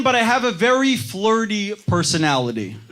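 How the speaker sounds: ordinary talk, nothing marked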